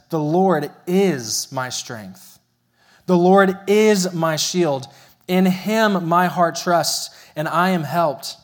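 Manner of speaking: slow, weighty reading of a psalm; scripture reading. Acted. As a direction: proclaim each line with steady conviction. Reading psalm aloud in sermon.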